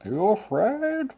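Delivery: high-pitched theatrical menace; hammed-up villain impression. Teasing co-host about strong emotions, mock villain voice. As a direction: taunt in a silly villain voice. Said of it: "like all my impressions, they're never any good".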